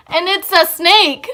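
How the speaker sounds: mock-horrified exclamation, loud and high; dramatic scare reveal. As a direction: punch out the shock reveal slowly. Reveals the snake in her scare story.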